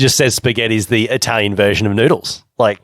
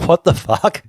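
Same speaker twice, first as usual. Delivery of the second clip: higher mock-baffled exclamation; incredulous friend; 6 semitones higher, slower than usual. Host imitating his baffled friend from India, higher. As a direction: play the friend's disbelief, lightly. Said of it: "and he's like".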